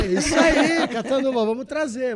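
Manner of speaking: loud laughing cheer; excited cheer. Real, not acted. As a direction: pump up the crowd. Cheering to bring trophy.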